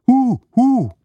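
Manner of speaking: high, falling owl hoots. A narrator's reading. Owl in the fir tree calls out, high hooting. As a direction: hoot twice like an owl, high and hollow.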